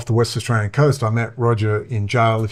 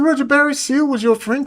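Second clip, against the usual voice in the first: much higher, stunned disbelief; amazed question. Retold or imitated. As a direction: ask it like you can't believe it. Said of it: "I said".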